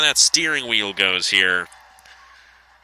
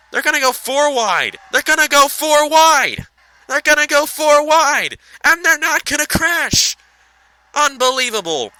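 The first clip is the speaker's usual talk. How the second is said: much higher and louder, building to a near-shout; excited race call; 14 semitones higher, 7 dB louder than usual. Real, not acted. Caller watching trucks go four wide, shouting excitedly. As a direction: yell it as they go four wide. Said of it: "My voice was going so high".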